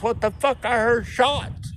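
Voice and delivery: a high mock Linda asking about shots; imitating Big Linda. Linda asking about shots, in a high imitated voice. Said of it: "she goes".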